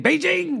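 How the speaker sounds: "Beijing" high-pitched in exaggerated Chinese tones; mock Chinese pronunciation. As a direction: overdo "Beijing" as a newscaster faking Chinese. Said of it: "they never do the Chinese".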